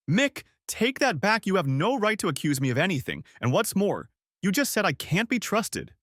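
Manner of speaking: furious wife hitting back; angry. A narrator's reading. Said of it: "she was truly angry".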